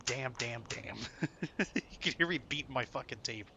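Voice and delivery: ordinary talk, nothing marked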